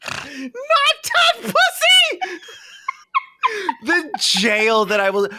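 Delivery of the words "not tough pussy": high squealing shout through laughter; laughing shout. Real, not acted. Reacting to her friend's tease, laughing shout. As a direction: shriek it while cracking up.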